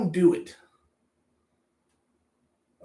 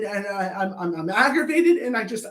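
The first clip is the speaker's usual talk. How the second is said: ordinary delivery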